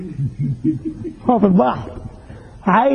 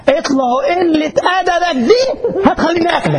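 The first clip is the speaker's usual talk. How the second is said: higher and laughing, a cat's fake anger; laughing mock threat; 7 semitones higher than usual. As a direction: threaten the mouse while cracking up. Cat threatens mouse, laughing.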